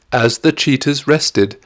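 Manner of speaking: plain reading voice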